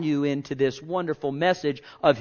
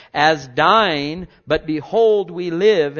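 proclaiming; 3 semitones higher, 7 dB louder, much slower than usual. Acted. Preacher proclaiming scripture.

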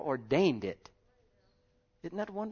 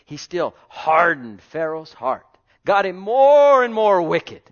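preaching at full force; 5 semitones higher, 11 dB louder than usual. Acted. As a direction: hammer it home to the church.

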